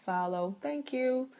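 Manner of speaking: usual speaking voice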